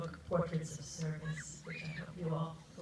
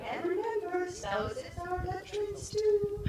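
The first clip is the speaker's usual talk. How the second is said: much higher, mock mother calling from afar; 3 dB louder than usual. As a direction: call it out like mom waving from the back.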